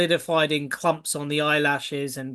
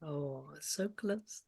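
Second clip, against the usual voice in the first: whisper; 6 dB quieter, slower than usual. Acted. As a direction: whisper it like a spooky secret. GM whispers as something near.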